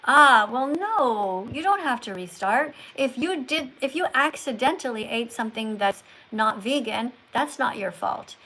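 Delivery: ordinary delivery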